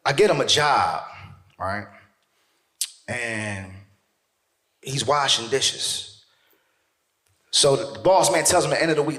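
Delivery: usual speaking voice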